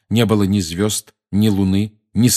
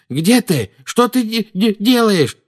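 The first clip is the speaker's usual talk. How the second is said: frightened; 13 semitones higher, 5 dB louder, slower than usual. Acted. Dudley frightened in the dark.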